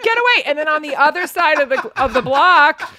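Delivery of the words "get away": mock warning yell. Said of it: "going like".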